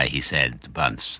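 ordinary delivery

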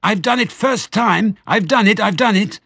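very high, loud triumphant yell; excited shriek. A narrator's reading. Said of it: "he yelled".

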